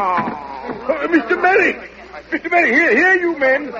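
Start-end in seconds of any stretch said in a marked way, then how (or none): 1.1-3.7 urgent calling. Acted. Captain calls his men in alarm when Merrick faints. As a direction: shout for the crew to help.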